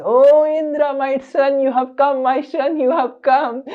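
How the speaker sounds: hammed-up mother welcoming her son; gushing mother. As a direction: greet the son with overdone affection. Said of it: "and Diti is".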